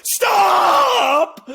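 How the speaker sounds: high, loud mock yell. Player yelling at the earworm, mock yell. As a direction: shout stop at the song in your head. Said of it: "and I'm like".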